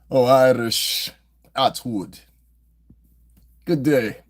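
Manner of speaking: slow, drawn-out mock Irish greeting; mock Irish accent. Mocking Atwood's Irish accent with a slow, loud stage-Irish greeting. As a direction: lay on a stage-Irish lilt, slowly. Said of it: "His accent is almost Irish".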